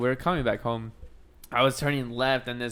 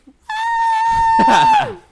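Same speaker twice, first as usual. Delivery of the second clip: long high, very loud whoop; loud whooping outburst; 14 dB louder than usual. Pretend. Reacting to the chichis joke, long high whoop. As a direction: let out one sustained high shriek.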